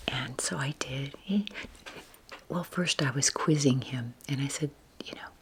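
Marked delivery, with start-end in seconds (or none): none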